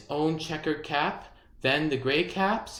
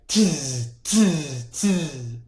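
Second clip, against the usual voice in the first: monkey tsk; much slower than usual. A narrator's reading. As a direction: click back at him like a monkey. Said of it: "and he said".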